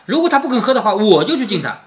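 ordinary delivery

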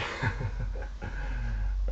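breathy chuckle; amused laugh. Real, not acted. Teacher amused by student mistake, chuckling. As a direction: laugh at your own silly imitation. Laughs at his own imitation.

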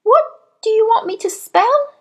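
put-on high little boy's voice; puzzled schoolboy. A narrator's reading. Boy baffled by the headmistress's order, puzzled. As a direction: plead confusion in a high child's voice.

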